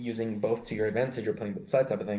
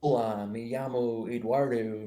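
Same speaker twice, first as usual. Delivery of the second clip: slow Spanish with a light American accent. Shown as a demonstration. Joking friends flip accents, Spanish in American accent. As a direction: say the Spanish like a gringo, slowly. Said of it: "Spanish with an American accent".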